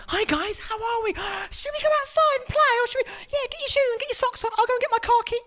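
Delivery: very high, bright mock-cheerful uncle voice; fake fun uncle. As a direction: lure the kids with fake fun. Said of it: "go round there and go".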